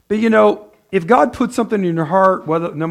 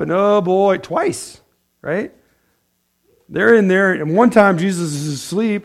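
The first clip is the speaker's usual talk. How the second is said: ordinary talk, nothing marked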